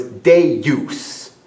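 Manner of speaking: "Deus" drawn out as DAY-yoos. Shown as a demonstration. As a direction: stretch "Deus" into day and use. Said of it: "E and U is pronounced".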